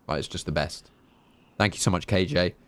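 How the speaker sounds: normal talking voice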